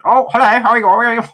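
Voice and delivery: put-on high, loud comic Welsh accent; mock a big man's Welsh squeak; David Prowse. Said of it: "When they get the Welsh actor".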